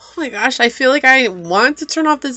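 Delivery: high, softened, tearful voice; barely holding back real tears; on the verge of crying. Real, not acted. Tarot reader overcome by the message for pile three, nearly crying. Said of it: "go and sit and cry".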